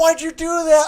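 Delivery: high, loud yelling at the TV; shouting. Retold or imitated. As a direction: shout at the players in disbelief. Mimics yelling at curling.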